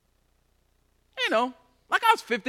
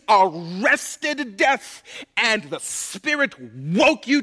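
slow, louder, climbing preacher's cry; preaching at full force; 3 semitones higher, 4 dB louder than usual. Acted. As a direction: lift the room with it, word by word.

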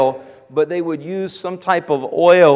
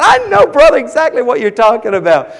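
much higher, louder on brother; excited agreement; 6 semitones higher, 4 dB louder than usual. Retold or imitated. Preacher answering the big man, eagerly agreeing. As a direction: gush along with him.

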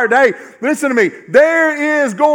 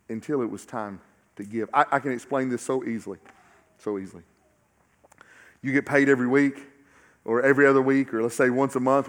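much lower and softer, close and confiding; hushed intimate preaching; 8 semitones lower, 8 dB quieter than usual. Acted. Preacher confiding to the congregation about giving, hushed. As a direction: draw the congregation in quietly.

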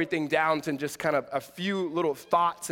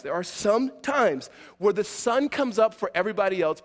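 ordinary talk

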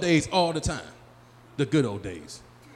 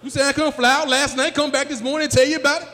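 incredulous; 10 semitones higher, 6 dB louder than usual. Retold or imitated.

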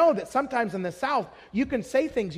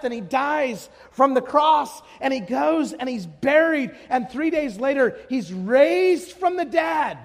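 full-force preaching; 3 semitones higher, 3 dB louder, slower than usual. Real, not acted. Preacher declaring Christ took my wrath, triumphant proclamation. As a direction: proclaim it with weight, stretching key words. Preaching builds to gospel climax.

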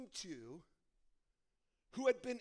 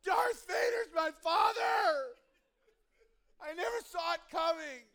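loud, higher, slow crying shout; anguished cry; 7 semitones higher, 14 dB louder, slower than usual. Retold or imitated. Luke hanging over the edge, loud anguished crying shout. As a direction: cry out in hammed-up despair. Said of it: "Luke does it ... going".

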